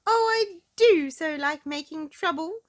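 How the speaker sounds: put-on high, loud gleeful little-girl voice; gleeful giggle. Miss Trouble hiding behind a tree, gleefully relishing mischief. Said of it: "she giggled to herself".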